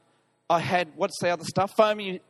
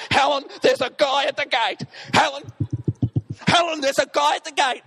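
urgent calls; 5 semitones higher, 4 dB louder than usual. Retold or imitated. Panicked texts to wife.

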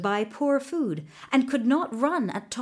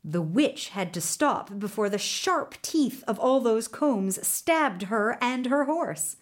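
ordinary delivery, nothing marked

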